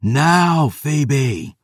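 war cry to his ally; bellowed. A narrator's reading. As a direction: bellow it over the thunder. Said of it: "I bellowed into the thunder".

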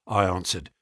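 ordinary delivery, nothing marked

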